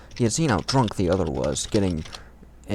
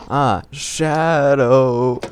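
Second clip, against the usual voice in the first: high, loud, drawn-out mock moan of a name; very slow hammed-up moan; mock moaned shout; 5 semitones higher, 6 dB louder, much slower than usual. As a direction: stretch it out like a hammed-up cry. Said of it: "The hero could barely shout".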